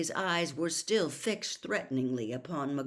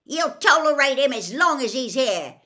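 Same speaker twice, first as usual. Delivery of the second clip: loud, higher angry yell; 9 semitones higher, 14 dB louder than usual. A narrator's reading. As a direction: shout back in defiance. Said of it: "he yelled".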